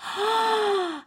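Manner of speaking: high, drawn-out mock gasp; long, high re-enacted gasp of shock; acted horrified gasp. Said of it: "And I went".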